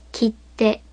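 切手 with a silent beat before "te"; 切手 with a held t; double consonant. Shown as a demonstration. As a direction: stop for a beat inside 切手, ki-(pause)-te. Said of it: "as if there is a syllabic length of silence".

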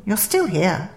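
posh and dismissive, kept soft; posh woman. A narrator's reading. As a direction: lightly put on a well-bred woman's voice. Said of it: "said the posh woman".

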